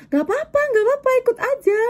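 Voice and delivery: high, quick coaxing; retold insistence. She urges the reluctant woman to come, retold high insistence. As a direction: brush off the refusal in a high, hurried voice.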